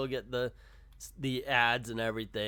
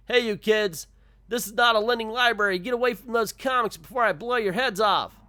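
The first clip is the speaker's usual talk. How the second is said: high, loud, fast scolding; angry shout; 11 semitones higher, 10 dB louder than usual. Acted. Shopkeeper chasing kids off the comics, angry shout. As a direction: yell at the kids to back off.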